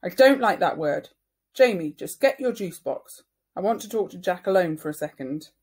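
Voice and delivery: loud, angry. Acted. Mother scolding Jamie then sending him out, loud and stern. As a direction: cut him off firmly. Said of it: "Mum yelled".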